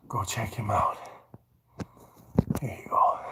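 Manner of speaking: soft unvoiced whisper. Real, not acted. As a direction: whisper so he won't notice. Peeking quietly at someone.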